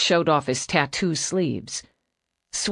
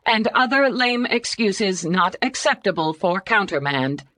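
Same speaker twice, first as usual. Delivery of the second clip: clipped synthetic computer voice; 6 semitones higher than usual. Acted. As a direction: recite it like a flat-toned computer. Computer reads rules.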